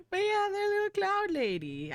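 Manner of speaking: very high, doting squeal; cutesy cooing. Real, not acted. Streamer showing off a little cloud lady, cutesy cooing. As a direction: coo it way up high.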